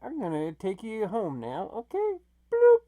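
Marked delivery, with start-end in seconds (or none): 2.5-2.8 loud, high cartoon "bloop"; teleport bloop. Host sending the guys home, high-pitched bloop sound effect. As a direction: make a high-pitched blip noise.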